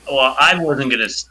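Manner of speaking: ordinary delivery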